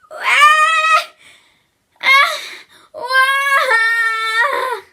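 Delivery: very high, loud screaming wail; pained scream. Acted. Bertha screaming after yanking her hair, very high and loud. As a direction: scream and bawl from the pain. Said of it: "she couldn't help but yell".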